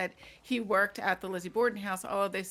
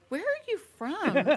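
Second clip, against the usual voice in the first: high, drawn-out incredulous question; baffled stranger; 7 semitones higher, 4 dB louder, slower than usual. Retold or imitated. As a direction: imitate a puzzled Bostonian, stretching it slowly. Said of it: "you have people tell you".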